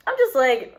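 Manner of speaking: usual speaking voice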